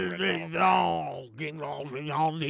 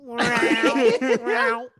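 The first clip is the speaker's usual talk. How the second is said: mooing tube toy; 6 semitones higher, 5 dB louder than usual. Shown as a demonstration. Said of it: "tubes that goes meow".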